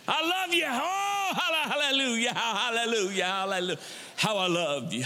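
hammed-up wailing praise, starting high and sinking; affected prayer voice. Pretending to woo a spouse in an overwrought prayer voice. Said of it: "talking to your spouse that way".

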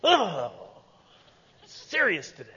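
hammed-up straining to be serious; groan, then force out mock resolve; mock seriousness. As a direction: pretend effortful earnestness. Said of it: "just get up in the morning and go".

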